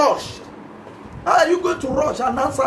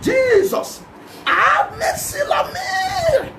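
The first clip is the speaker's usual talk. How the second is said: crying out; 14 semitones higher, much slower than usual. Shown as a demonstration. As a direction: wail it like you mean it. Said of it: "you will cry it and it will echo".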